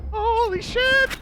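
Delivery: awed outburst at rare loot; stunned exclamation. Real, not acted. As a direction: gasp then shocked shout.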